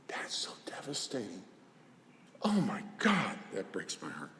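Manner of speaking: real whisper, hushed and sad; heartbroken whisper. Real, not acted. Therapist to the audience about rejected parents, hushed whisper. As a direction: let it fall to a whisper.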